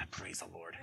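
drained parent mumbling it; dead-tired mock praise; weary mutter.

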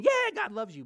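loud, over-the-top "Yeah, God loves you"; overhyped. Shown as a demonstration. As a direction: pump it up like a cheesy cheerleader. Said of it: "It also doesn't mean".

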